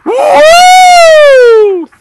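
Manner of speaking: very high, loud laughing whoop; cheering whoop. Retold or imitated. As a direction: whoop like an excited crowd in the front row. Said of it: "people sitting on the front row would go".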